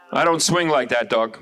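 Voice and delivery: ordinary talk, nothing marked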